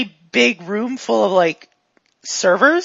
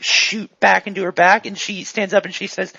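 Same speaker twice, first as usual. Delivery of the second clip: ordinary talk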